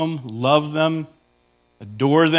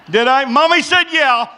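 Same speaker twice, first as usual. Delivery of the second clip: put-on childish husband; 10 semitones higher, 5 dB louder than usual. Mock husband asks wife.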